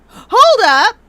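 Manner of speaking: loud, high startled cry; sudden loud gasping outcry; shocked shout. Acted. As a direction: blurt it out in disbelief. Witness shocked at photo.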